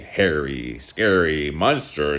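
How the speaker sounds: slow, drawn-out spooky narration; storybook monster build-up, slowly; ominous storytelling. A narrator's reading. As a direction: stretch each word to build mock menace.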